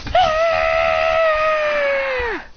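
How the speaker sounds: sustained very high squeal; drawn-out group squeal, acted; long fangirl scream. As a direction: hold a piercing high shriek. Girls scream at team news.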